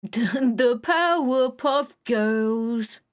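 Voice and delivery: put-on British accent, very slow and drawn out; playful sung imitation of a British accent. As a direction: sing it out in an exaggerated British accent. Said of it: "jump scared by that accent".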